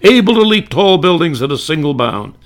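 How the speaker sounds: radio announcer. Retold or imitated. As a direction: sell it like a golden-age announcer. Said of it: "something like this".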